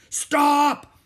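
very high, loud yell; desperate shout. Acted. Captain running after his men, shouting.

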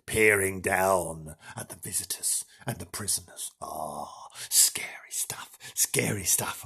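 low hushed ghost-story voice; spooky hush. Acted. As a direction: creep them out, almost whispering.

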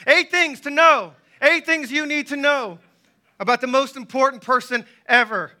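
loud, high-pitched preacher's yell; excited yelling. Real, not acted. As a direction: yell it out with excitement, hammering each phrase. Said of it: "I'm yelling because I'm excited".

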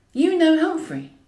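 high, loud, slow disbelief; can't believe she heard the name; astonished question. Acted. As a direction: draw it out in amazed disbelief. Louise stunned by the name.

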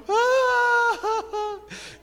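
high, loud imitated bawl; mock wailing sob held long; ugly crying wail. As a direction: bawl like someone crying hideously. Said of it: "empezó a llorar bien feo".

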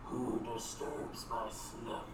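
low, slow growling whisper; dusty mummy rasp. Acted. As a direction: rasp it out like an ancient thing waking. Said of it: "you hear a voice growling at you".

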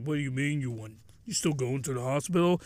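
lightly acted husband, low and soft; a low, subdued questioning husband; husband's voice. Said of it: "He's like".

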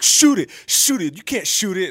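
put-on high, loud, slow taunt from a kid. Son taunting his dad on the court, high and loud. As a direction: goad him like a cocky little kid.

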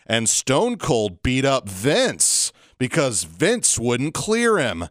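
normal talking voice

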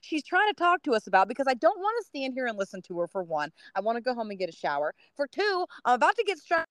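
high, fed-up venting; exasperated rant. Retold or imitated. Tired runner venting about the chatty neighbor, high and exasperated. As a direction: complain you're done with it all. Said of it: "And I'm like".

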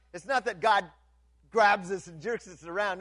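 ordinary talk, nothing marked

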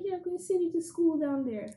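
mom's line in a lifted Jamaican lilt; Jamaican accent. Retold or imitated. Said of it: "Can you say it in the accent?".

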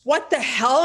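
loud, high burst of disbelief; shocked outburst. Retold or imitated. Friend seeing the big offer, shocked outburst. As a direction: burst out in retold astonishment.

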